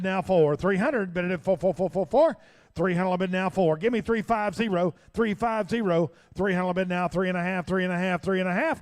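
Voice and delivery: loud rapid auction chant; auctioneer. Acted. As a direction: rattle off bids, building to sold. Auctioneer bid calling.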